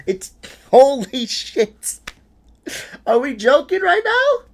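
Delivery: can't believe what you're seeing; real disbelief. Real, not acted. Streamer reacts to Charlie's reversal in high, loud disbelief.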